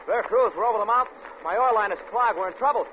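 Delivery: urgent mayday. Acted. Pilot reporting fake trouble to the tower, urgent shout. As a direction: shout it over the engine. Mayday over engine noise.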